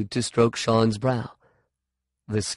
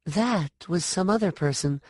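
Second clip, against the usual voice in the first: put-on high, insistent girl's voice; girl's protest; 8 semitones higher, 3 dB quieter than usual. A narrator's reading. Sean insisting to the woman, high. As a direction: set her straight.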